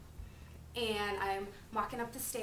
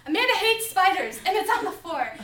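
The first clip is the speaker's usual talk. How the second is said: put-on excited child; 9 semitones higher, 12 dB louder than usual. Child explains to mom excitedly.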